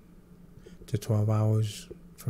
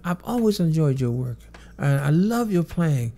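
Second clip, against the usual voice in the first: pitched-up Michael impression, thrilled; imitating Michael; 6 semitones higher, 6 dB louder than usual. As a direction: lift the pitch into his voice, gushing. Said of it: "He says".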